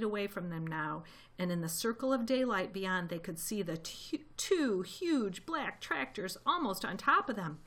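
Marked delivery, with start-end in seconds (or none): none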